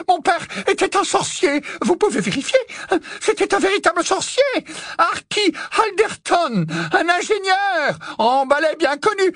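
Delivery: high, frantic, desperate begging; terrified pleading. Acted. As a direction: plead for your life in panic. (supplication terrifiée)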